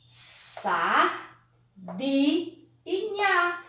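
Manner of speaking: SAR, DI, NHA slow and spaced; syllable by syllable. Shown as a demonstration. Teacher forming a word from syllables, slowly sounded out. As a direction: build sardinha one drawn-out syllable at a time. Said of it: "Que palavra eu posso formar?".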